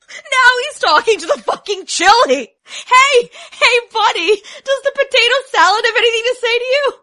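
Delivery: loud, high jeering; mocking shout. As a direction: jeer at him across the room. Server mocks loudly.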